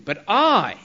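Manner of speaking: "I" pitched high and held; stress on "I". Shown as a demonstration. Preacher voicing Jesus against other leaders, emphatic "I". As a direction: land emphatically on "I", then stop. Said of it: "emphasis is very emphatically on the".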